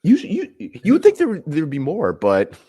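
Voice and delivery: high, loud mock disbelief; squeaky put-on astonishment; incredulous. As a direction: act baffled at the stats. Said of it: "And you just sit there like".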